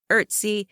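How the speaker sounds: Ertzi, opening on an "er" vowel; second pronunciation variant. Shown as a demonstration. Narrator lists ways a nickname is pronounced, second pronunciation variant. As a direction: say "Ertzi" as ERT-see. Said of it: "pronounced three different ways".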